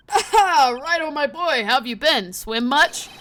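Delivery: loud greeting, lightly put on; hearty police chief. Police chief greeting Righto, his boy, hearty. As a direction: hail him with a big opening burst.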